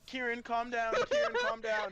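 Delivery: very high, loud squeaky panic; frantic, high-pitched imitation of calming someone; mock pleading. Imitating Luca begging a friend to calm down, high and frantic. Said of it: "Luca would normally be like".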